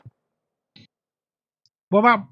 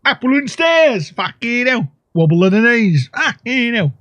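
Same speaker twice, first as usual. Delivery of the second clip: high, hammed-up Cockney caricature; mock Cockney voice; 7 semitones higher than usual. Player explaining batter as a Cockney, high mock Cockney accent. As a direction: squawk it like a cartoon Cockney. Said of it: "Obviously, I enjoy that voice".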